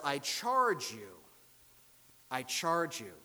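slow, weighty exhortation; solemn, emphatic charge. Acted. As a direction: lean hard on CHARGE, drawn out and falling. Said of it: "This is a solemn and emphatic exhortation".

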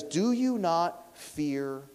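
slow, drawn-out mock rebuke; hint of a criminal scolding his neighbour; lightly voiced rebuking criminal.

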